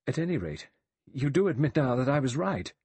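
high, pressing question; insistent cry. A narrator's reading. Vera demands Lombard admit she's right, insistent. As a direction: press for agreement, pitch lifted. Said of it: "Vera cried".

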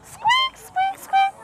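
very high-pitched little squeaks; mouse squeaking. Acted. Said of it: "the children all said".

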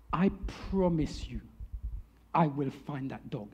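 low, hushed, earnest reassurance; solemn promise. Acted. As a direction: promise it quietly, in a low, measured voice. Manager solemnly reassures upset lady.

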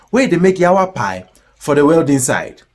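normal talking voice

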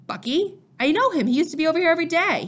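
high-pitched, surprised question and reply; startled disbelief. Acted. Father hearing of Bucky's arrest, startled disbelief. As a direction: react in astonishment to the news.

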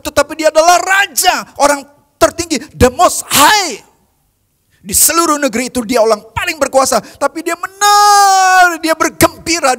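preaching at full force. Acted. Preacher proclaiming the king danced, full-force shout. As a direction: lift the congregation with a cry. Preaching climax on David's humility.

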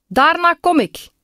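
put-on high-pitched child's call back. A narrator's reading. Boy pirate calls back that he will come later, high-pitched call. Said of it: "riep hij".

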